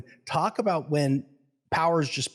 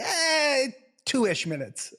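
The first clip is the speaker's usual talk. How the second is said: mock shrug; 12 semitones higher, much slower than usual. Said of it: "we're saying".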